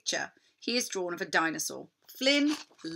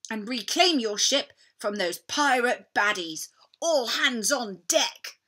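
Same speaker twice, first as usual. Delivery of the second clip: higher, louder battle call; cried out; 5 semitones higher, 6 dB louder than usual. Acted. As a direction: urge everyone on. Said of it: "cried Captain Flynn".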